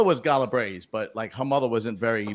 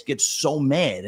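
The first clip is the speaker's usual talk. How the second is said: ordinary delivery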